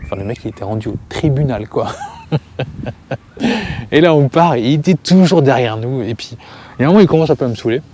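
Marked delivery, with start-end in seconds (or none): 1.9-3.9 high burst of real laughter. Real, not acted. Narrator laughing at the camper's tribunal threat, high real laugh. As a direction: laugh out at the absurdity.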